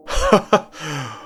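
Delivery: brief laugh in Irwin's character; acted laugh. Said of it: "Erwin laughed".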